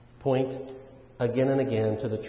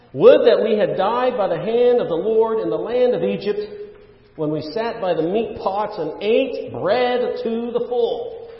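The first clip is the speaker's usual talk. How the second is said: raised, emphatic complaint read from scripture; grumbling complaint; 5 semitones higher, 5 dB louder than usual. Acted. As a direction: read it as the people's protest, loud at first, easing off at the end. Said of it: "people of Israel grumbled".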